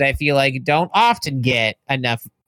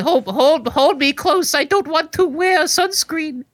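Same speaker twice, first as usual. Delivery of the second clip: put-on high, slow sing-song Swedish accent; 11 semitones higher than usual. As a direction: pale Swede afraid of the sun, Swedish accent. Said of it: "Is this your Swedish accent?".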